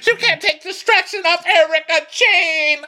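very high, loud mock wail; hammed-up squawking housewife. Cast member defending Erica Jane, loud high caricature. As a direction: squawk it at the top of your range.

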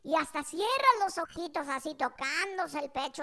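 ordinary delivery

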